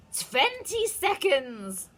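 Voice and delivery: high, slow gloating witch cry. A narrator's reading. Witch counting down to the crowd, high and gloating. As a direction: count down with cruel glee. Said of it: "cried the Grand High Witch".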